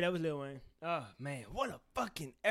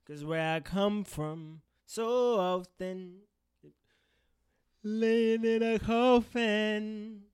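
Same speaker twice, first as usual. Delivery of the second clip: spoken word intro; 2 semitones higher, much slower than usual. Acted. Spoken-word intro over music.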